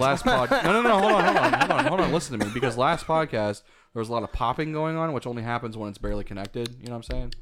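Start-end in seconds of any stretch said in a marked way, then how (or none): 0.5-2.1 high insistent laughing interruption; laughing hold on. Real, not acted. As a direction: cut in urgently, laughing. Interrupting with urgency.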